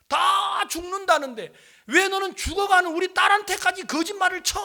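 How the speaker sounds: high, loud, sustained shouting; retold angry accusation. Relative berating preacher over dying daughter, angry shout. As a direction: imitate a relative raging at the visitor.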